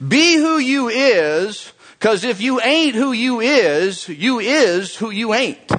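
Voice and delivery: high, slow, drawn-out preacher delivery; hammed-up Southern preacher quote; imitating a Southern preacher. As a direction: channel the fiery preacher, stretching each phrase.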